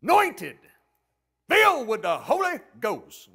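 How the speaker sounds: loud, hammed-up Pentecostal show-off; boastful Pentecostal churchgoer. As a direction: boast loudly like a revival preacher, punching big words. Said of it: "But in the Pentecostal church".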